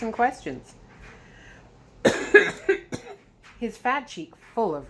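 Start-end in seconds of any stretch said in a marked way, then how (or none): 2.0-3.1 cough. Real, not acted. Narrator coughs mid-reading.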